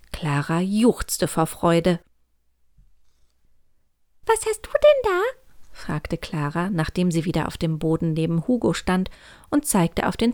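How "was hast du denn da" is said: piepsiges, hohes Kükenstimmchen; peeping baby chick. Acted. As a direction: neugierig piepsend fragen. Küken Clara fragt neugierig. (piepsendes Küken)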